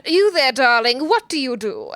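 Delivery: imitated transatlantic stage-diva accent; Lucy Darling. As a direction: greet the crowd in a put-on mid-Atlantic accent. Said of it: "in that sort of persona".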